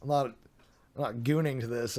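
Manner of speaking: usual speaking voice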